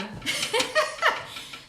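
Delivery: a high, airy burst of laughter; high breathy giggle. Real, not acted. To her viewers, a high airy giggle. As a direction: giggle at the bargain.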